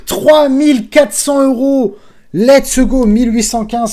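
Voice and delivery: high, loud celebration with a laugh; jubilant. Real, not acted. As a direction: shout the winnings in triumph. Celebrating the final bonus total. (explosion de joie)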